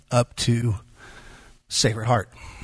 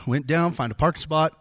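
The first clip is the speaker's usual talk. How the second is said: normal talking voice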